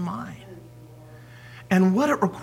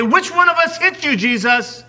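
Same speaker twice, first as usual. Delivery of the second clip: a mock taunt pitched higher and louder; 11 semitones higher, 5 dB louder than usual. Preacher voicing soldiers taunting Jesus, mocking. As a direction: jeer it like a cruel soldier.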